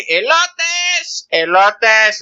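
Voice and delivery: high sing-song vendor call. Retold or imitated. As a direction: call out elotes like a street seller. Street vendor calling out.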